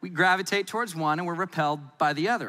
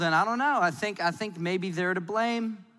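normal talking voice

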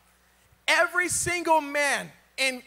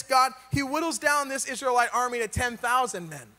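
ordinary talk

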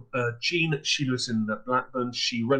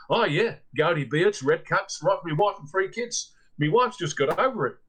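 put-on earnest Australian accent, lightly done, a touch higher; 3 semitones higher than usual. Australian guest describes the soldiers to the landlady, light Australian accent. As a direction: describe the ghost soldiers like a rattled Aussie. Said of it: "from the same Australian".

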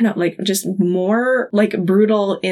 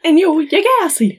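mock rant; 9 semitones higher than usual. End of quoted freak out.

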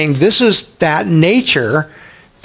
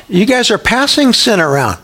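normal talking voice

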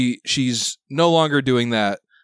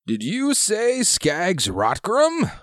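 put-on old sailor voice; 10 semitones higher, much slower than usual. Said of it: "and he says".

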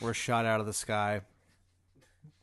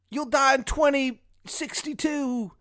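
high, slow, loud cry of mock agony; tortured Shithead Steve; 8 dB louder, slower than usual. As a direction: shout the prophecy out slowly through the torture. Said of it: "like, tortured".

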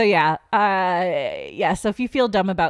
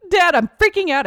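higher, louder panicky plea to dad; panicked call; 9 semitones higher, 4 dB louder than usual. Retold or imitated. Retelling calling her dad from the gas pump, panicky and higher. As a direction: sound frantic on the phone. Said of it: "I'm like".

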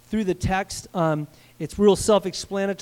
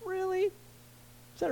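incredulous really; 12 semitones higher, 12 dB quieter, much slower than usual. Real, not acted. Preacher doubting their memory.

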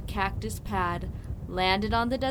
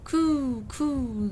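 bird coo; 4 semitones higher, 3 dB louder than usual. Acted. Rodney answering Quincy, bird coo. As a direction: coo like a roadrunner.

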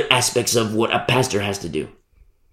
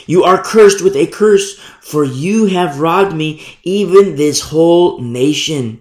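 louder, slowed proclamation; loud solemn curse; 4 semitones higher, 5 dB louder, slower than usual. A narrator's reading. God cursing the nation, loud slow proclamation.

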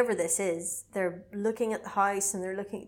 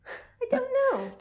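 silly high voice; 3 dB louder, slower than usual. Pretend.

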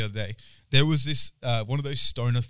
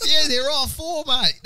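high, loud broad mock-Aussie; laughing through a hammed-up Aussie voice; country Aussie local; 14 semitones higher, 6 dB louder, slower than usual. Shopkeeper gives the price, hammed-up country Aussie. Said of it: "I was in country Australia".

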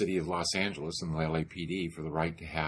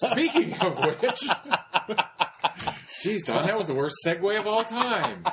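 laughing; 14 semitones higher, 6 dB louder than usual. Real, not acted. Laughing at the bad segue.

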